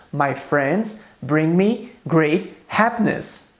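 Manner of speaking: slow, careful example for learners; slow model sentence. Shown as a demonstration. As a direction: read it slowly, word by word. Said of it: "Então eu posso dizer em inglês". (frase lenta para os alunos)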